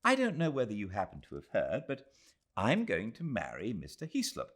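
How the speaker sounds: put-on a young woman confiding her news; young Englishwoman. Adela telling Aziz of her engagement, earnest.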